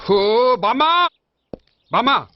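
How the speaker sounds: urgent holler for grandma; loud calling out. Acted. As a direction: call out loudly to summon someone in the house. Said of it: "ఎందుకురా అంత గట్టిగా అరుస్తావు".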